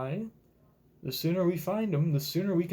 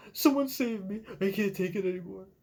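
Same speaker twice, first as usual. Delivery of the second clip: put-on pleading child; 5 semitones higher than usual. Reading child Kuma's cry.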